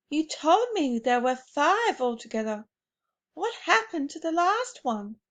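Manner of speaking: hint of a child pestering his grandmother; young boy. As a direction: ask it as a curious seven-year-old. Narrator voices the young boy.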